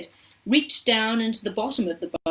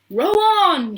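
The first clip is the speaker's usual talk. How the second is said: loud, high, drawn-out command; shouted order; 9 semitones higher, 8 dB louder than usual. A narrator's reading. Mister Wonka to his rowers as the boat enters the tunnel, shouted. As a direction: call out to the rowers. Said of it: "shouted Mister Wonka".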